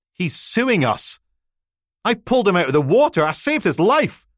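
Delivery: high, loud, indignant protest; can't believe it, rising outrage; outraged disbelief. Acted.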